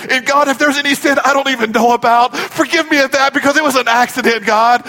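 imitating a panicked, high-pitched prayer; intense rising prayer. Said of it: "the intensity in Richard's voice starts rising".

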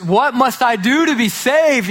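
high, slow, urgent pleading; man begging the evangelist to be saved; desperate plea. Retold or imitated. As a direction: beg for an answer. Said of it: "in desperation goes".

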